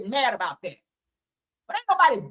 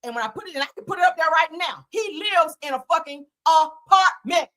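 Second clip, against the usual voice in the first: ordinary talk, nothing marked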